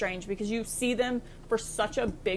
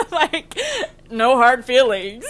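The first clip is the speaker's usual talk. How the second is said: louder, laughing reassurance; 2 semitones higher, 9 dB louder, slower than usual. Real, not acted. Joking to absent friend Josh, laughing. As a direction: say it through laughter, drawn out.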